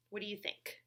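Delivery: normal talking voice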